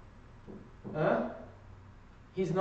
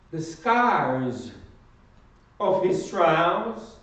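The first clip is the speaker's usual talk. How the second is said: loud, emphatic sermon delivery; forceful preaching; 3 semitones higher, 7 dB louder than usual. Real, not acted. Preacher telling the congregation of Christ's suffering, forceful preaching.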